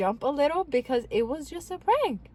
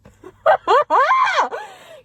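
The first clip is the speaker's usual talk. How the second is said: high, loud burst of laughter; shrieking laugh; loud cackle; 9 dB louder than usual. Real, not acted. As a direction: cackle helplessly at your own prank. Laughs at her own prank story.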